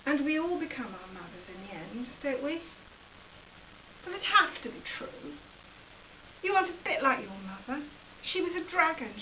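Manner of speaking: ordinary delivery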